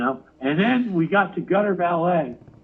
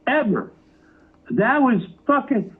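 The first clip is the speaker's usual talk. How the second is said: normal talking voice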